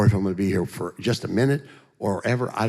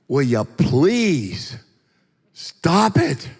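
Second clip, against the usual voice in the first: louder, higher fed-up plea with a drawn-out please; comic exasperation, pitched up; exasperated plea; 6 semitones higher, 5 dB louder, much slower than usual. Retold or imitated. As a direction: act out begging them to stop, loudly exasperated. Exasperated plea at wife and doctors.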